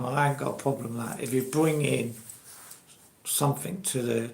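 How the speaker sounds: normal talking voice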